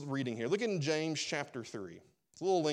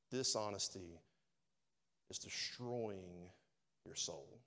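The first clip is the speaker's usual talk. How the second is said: low, hushed, slow and weighty; solemn, quiet pulpit warning; grave warning; 6 semitones lower, 8 dB quieter, slower than usual. Real, not acted. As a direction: drop right down and space out each phrase. Preacher's solemn warning to congregation.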